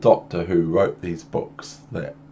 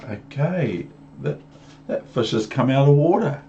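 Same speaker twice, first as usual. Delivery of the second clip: ordinary delivery, nothing marked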